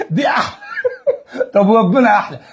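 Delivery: usual speaking voice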